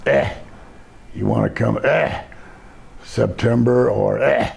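halting, with grunted "eh" between phrases; Stu. Retold or imitated. Trainer booking wrestlers on the phone, halting grunting imitation. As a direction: imitate someone struggling to get the words out. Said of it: "to get the words out".

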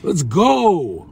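high, drawn-out celebratory shout; hyped cheer. Real, not acted. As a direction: cheer it out loud, stretching the last word. Hyped celebration after a compliment.

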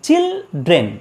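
চিলড্রেন in two separate beats; চিল held, short gap, then ড্রেন; syllable by syllable. Shown as a demonstration. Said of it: "দুবার stroke করছে".

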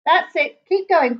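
lion calling to the troop. A narrator's reading. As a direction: call encouragement up the line. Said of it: "called Ralph".

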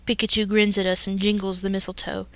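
plain reading voice

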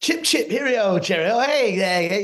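high, hammed-up mock Cockney. Co-host likening actor's accent to an old film star, hammed-up British accent. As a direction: chirp it in a cartoonish British accent.

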